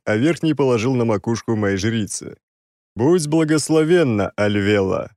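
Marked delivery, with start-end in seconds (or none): 3.0-5.1 solemn, raised proclamation of "Будь благословенна". Acted. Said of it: "как можно торжественнее провозгласил я".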